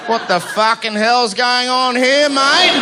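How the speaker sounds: put-on Australian accent. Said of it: "confused Australian man".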